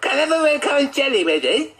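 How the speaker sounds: high, fast, mumbled John voice; garbled mumble. Acted. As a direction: rush the words out high and blurred. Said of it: "You can hardly understand a word he says".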